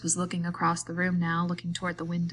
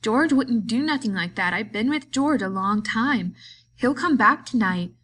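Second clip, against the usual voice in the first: higher, louder insistence; insist, getting more upset; a frightened defence of George; upset protest; 4 semitones higher, 6 dB louder than usual. A narrator's reading.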